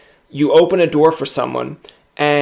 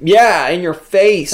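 taunting; 5 semitones higher, 6 dB louder, slower than usual. Retold or imitated. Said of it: "they'd be like".

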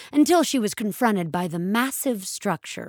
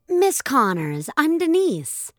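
customer service voice; 6 semitones higher than usual. A narrator's reading. Chirpy customer service girl.

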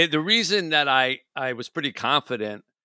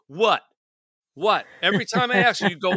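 mock exasperated; 7 semitones higher than usual. Acted quarrel mimicking the annoyed what.

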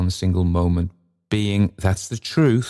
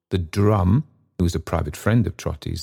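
usual speaking voice

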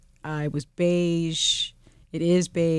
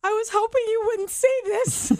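much higher, put-on mother voice; a high, guilty mother's confession; mother's words. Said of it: "runs downstairs and says".

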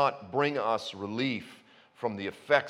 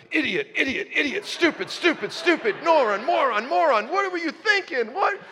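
high, louder, frantic self-insults; self-scolding inner voice; 6 dB louder than usual. Retold or imitated. Preacher mocking himself after the failed backflip, squeaky and loud. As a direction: berate yourself in a squeaky panic. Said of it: "and I just went".

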